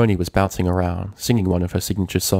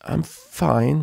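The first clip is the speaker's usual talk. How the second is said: lying; 8 semitones higher than usual. A narrator's reading. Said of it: "Rainbow lied".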